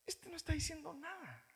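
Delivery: high, soft dismissive murmur; dismissive aside. Retold or imitated. Mature believer judging a preacher, soft dismissive murmur. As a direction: mutter it like an unimpressed listener. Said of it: "porque dice".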